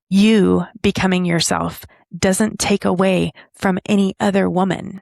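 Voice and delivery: ordinary delivery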